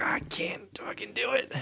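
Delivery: slow, soft whispered admission; whispered mock confession. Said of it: "I was like".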